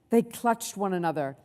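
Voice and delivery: ordinary delivery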